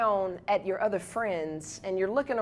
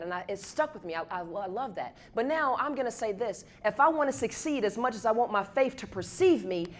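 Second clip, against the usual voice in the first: ordinary delivery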